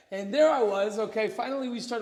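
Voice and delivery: ordinary delivery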